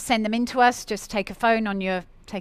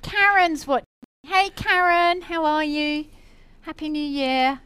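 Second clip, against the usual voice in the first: excited greeting; 7 semitones higher, 4 dB louder, slower than usual. Real, not acted. Greeting a viewer live.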